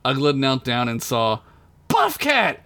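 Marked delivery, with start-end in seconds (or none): 1.9-2.6 high-pitched alarmed cry; yelled name. A narrator's reading. Narrator voices Ugla finding her friend knocked out, yelled. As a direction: yell the name out, pitch leaping up. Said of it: "She yelled".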